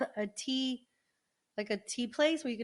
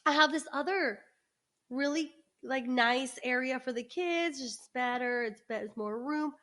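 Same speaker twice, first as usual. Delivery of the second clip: imitating the owner; 3 semitones higher than usual. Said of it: "and she's like".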